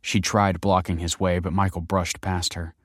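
usual speaking voice

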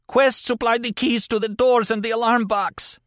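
very high, strained denial; pained, desperate captive. A narrator's reading. Injured captive denying the killing to his interrogator, desperate. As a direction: plead it high, through agony.

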